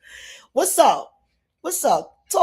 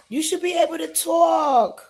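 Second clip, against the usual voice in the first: frustrated call; 8 semitones higher than usual. Real, not acted. Guest's mic still not working.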